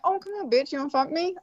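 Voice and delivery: light, joking come-on; jovial demo. Shown as a demonstration. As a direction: toss it off as friendly banter. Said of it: "if I'm using a jovial like that".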